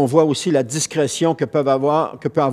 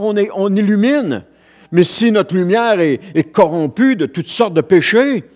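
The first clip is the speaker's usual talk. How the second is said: high, loud fervent preaching; preaching at full force; 8 semitones higher, 5 dB louder than usual. Acted. Pastor rousing his congregation about being the light, fervent preaching. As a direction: proclaim it to the whole church.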